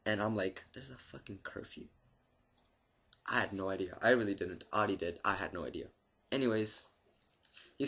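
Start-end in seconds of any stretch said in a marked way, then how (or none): 0.7-1.9 hushed disbelief. Retold or imitated. Stunned reaction to cop.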